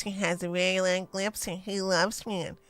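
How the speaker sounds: lightly voiced lisping man; mocking a lisp, mildly. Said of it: "he talk like this".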